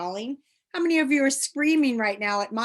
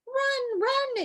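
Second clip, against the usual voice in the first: crying out. Acted. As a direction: call out a high urgent warning. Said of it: "it shouted again".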